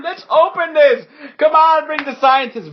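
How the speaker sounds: high, loud impatient order; called-out command. Acted. Director orders the scientist brought, loud and impatient. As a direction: call out across the site to get things moving.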